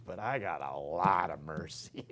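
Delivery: soft unvoiced confession of mercy; whispered aside. Real, not acted. Preacher whispers to his listeners, a hushed confession. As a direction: let it slip as a hushed confidential aside.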